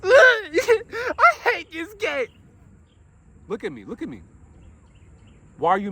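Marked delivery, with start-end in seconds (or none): 0.0-2.3 put-on high, slow, crying whine of a child; mock a kid wailing mid-tantrum. Imitating a child throwing a tantrum, whiny cry. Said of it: "If they're mad, throwing tantrums". 3.5-4.2 soft, firm calm. Retold or imitated. Calming a child mid-tantrum.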